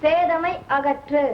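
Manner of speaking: very slow, drawn-out schoolroom recital; pupil's recitation. Acted. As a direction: recite it word by word, slowly. Pupil recites a memorised line. (மெதுவாக ஒப்பிக்கும் குரல்)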